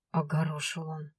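ordinary delivery, nothing marked

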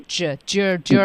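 "j-, j-, during" starting on a j sound; second variant. Shown as a demonstration. Host repeats the student's second option, j-initial "during". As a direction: sound out j twice, then "during" as jur-ing. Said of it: "包括第二种".

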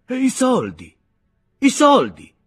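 high, loud, insistent asking for the money; repeating the question loud and sharp; loud demand. A narrator's reading. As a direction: press him hard about the money.

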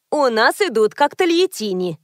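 ordinary delivery, nothing marked

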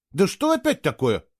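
high, fed-up question; losing patience. A narrator's reading. Boy stopped at the portal, losing patience. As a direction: ask as patience wears thin. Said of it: "начиная терять терпение".

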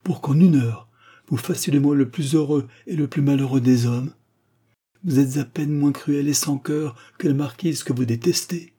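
high-pitched, impassioned reproach; exalted tone. A narrator's reading. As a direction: pour out a lover's ardent complaint. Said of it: "s'écria-t-il d'un ton exalté". (ton exalté, voix haute et passionnée)

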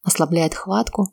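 plain reading voice

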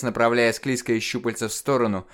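ordinary delivery, nothing marked

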